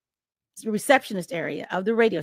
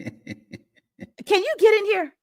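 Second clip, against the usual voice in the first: calling out; 9 semitones higher, much slower than usual. Retold or imitated. Anton calling her from lobby.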